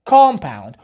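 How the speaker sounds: "compound" said the American way, stressed open first syllable; "compound" as KOM-pown, American style; American pronunciation. Shown as a demonstration.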